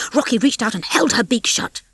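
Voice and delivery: plain reading voice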